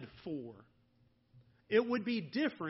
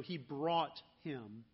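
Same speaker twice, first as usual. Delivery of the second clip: "he brought him" slow and low, "brought" pressed; stress on brought; 5 semitones lower, slower than usual. Shown as a demonstration. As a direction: press the word "brought", slowly. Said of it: "note this phrasing".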